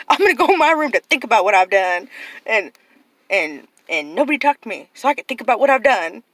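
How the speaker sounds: put-on loud, amused retelling of the kid; half-laughing, hammed-up kid quote; laughing imitation. Aunt repeating nephew's timeout line, loud and amused.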